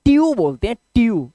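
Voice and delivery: ट्यू said as one clipped syllable, tyoo; correct pronunciation. Shown as a demonstration. Teacher showing students the right ट्यू. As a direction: model ट्यू carefully as tyoo. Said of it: "right pronunciation ट्यू है".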